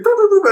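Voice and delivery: high, silly recorder noises; imitated recorder tooting. Clown driver playing two recorders at once, silly tooting. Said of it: "starts playing the recorders".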